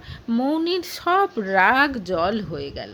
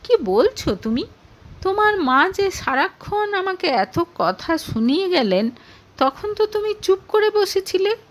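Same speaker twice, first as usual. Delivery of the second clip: surprised; 8 semitones higher than usual. A narrator's reading. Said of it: "অবাক হয়ে".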